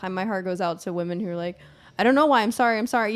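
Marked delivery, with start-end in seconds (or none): 2.0-3.1 apologetic woman. Retold or imitated. Voicing apologetic crying women.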